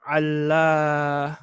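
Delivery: "Allamatun" stretched slowly, held before the ending; drawn-out model word. Shown as a demonstration. Teacher answering "you would say what?", slowly drawing out "Allamatun". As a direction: draw out "Allamatun" syllable by syllable.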